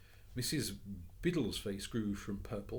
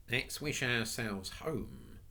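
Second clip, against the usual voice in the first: plain reading voice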